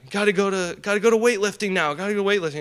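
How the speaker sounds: high, loud, hurried wake-up call; roommates urging. Retold or imitated. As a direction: rush him out of bed. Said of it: "my roommates would wake me up".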